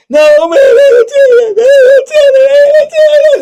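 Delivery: very high, loud wailing cry; crying panic. Retold or imitated. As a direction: wail in terror they'll tell. Victim panics at threat.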